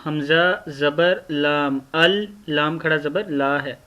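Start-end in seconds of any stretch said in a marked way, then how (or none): none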